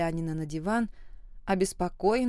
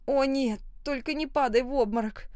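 higher, alarmed cry; 6 semitones higher than usual. A narrator's reading. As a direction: panic as he sways. Lana alarmed as he sways.

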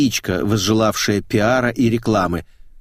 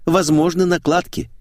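ordinary delivery

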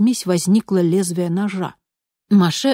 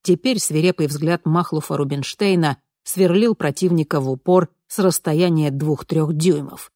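plain reading voice

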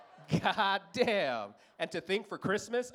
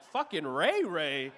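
incredulous; 4 dB louder, much slower than usual. Retold or imitated. Reacting to the cop's name idea.

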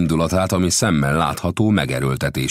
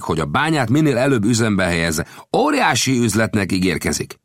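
higher-pitched voice for the gambusino, earnest storyteller; gambusino's voice; 11 semitones higher, 4 dB louder than usual. A narrator's reading. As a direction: tell the tale as the friendly gold digger. Gambusino tells of the mine.

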